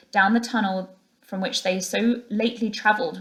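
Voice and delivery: plain reading voice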